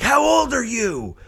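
high mocking little-boy voice; bratty kid. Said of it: "and the kid's like".

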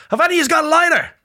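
high, quick Irish brogue; played-up Irish accent. Comic asking women for a lighter, hammed-up Irish accent. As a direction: lay the Irish on thick, high-pitched. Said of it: "I have played it up".

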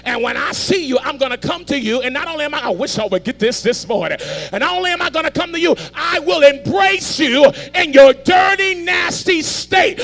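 a booming divine promise that builds up; God's voice. Acted. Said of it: "God's like".

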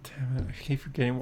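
whispered mock regret; a rueful whispered aside. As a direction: hiss it under your breath like a sore loser.

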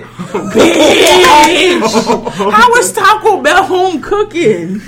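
high, slow, loud disbelief; incredulous shout. Retold or imitated. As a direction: yell it in total disbelief. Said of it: "I said".

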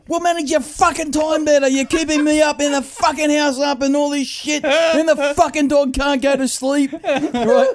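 high, ranting imitation of Dad; hammed-up exasperated tirade, held high throughout; irate dad. Dad scolds son for keeping the house up, mock rant. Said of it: "He'd go".

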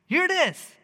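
gasp, then burst out high and loud; acted burst of awed excitement, punching each short phrase; excited. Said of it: "if you don't get excited about it".

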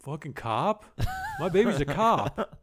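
lightly voiced dumb dad; mock Rob voice. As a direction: um pai bobo, de leve. Voicing Rob in movie recap.

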